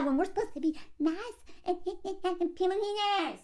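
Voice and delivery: small, soft mocking voice; hushed, hammed-up plea for niceness; mock scolding woman. Mocks women demanding niceness.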